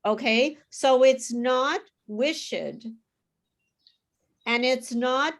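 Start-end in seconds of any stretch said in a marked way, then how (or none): 2.1-2.5 "wished" as two syllables, wish-ed; mispronounced extra syllable. Shown as a demonstration. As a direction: wrongly sound the E in "wished": WISH-id. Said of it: "the E's are not pronounced".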